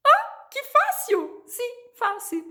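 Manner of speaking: very high, slow "Ah, que fácil!"; mock delighted student. Playing a pleased student who finds Spanish easy. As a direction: squeal like a thrilled pupil.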